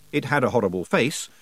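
ordinary talk, nothing marked

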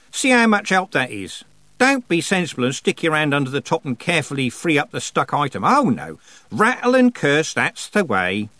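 high, mocking running commentary; sardonic goddess. A narrator's reading. Goddess watching a girl curse a stuck drawer, sardonic. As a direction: scold with heavy sarcasm, pitch jumping up. Goddess figure voiced in story.

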